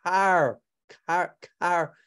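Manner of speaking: "car" tried several times, straining for the final R; halting attempts at the R in "car"; struggling to pronounce R. Shown as a demonstration. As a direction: labour at the R ending of "car". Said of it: "I can't even pronounce it".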